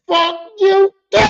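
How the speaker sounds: high, loud "fuck you"; mock snapping curse. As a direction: throw it right back at him. Friend snapping back.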